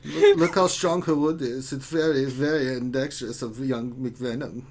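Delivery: put-on naughty German guy accent; dirty German accent. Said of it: "it sounds so dirty when you say it in a German accent".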